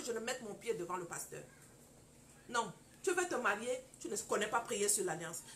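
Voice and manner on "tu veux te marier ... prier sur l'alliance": ordinary delivery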